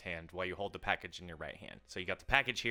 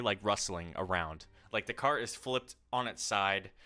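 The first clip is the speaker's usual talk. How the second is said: normal talking voice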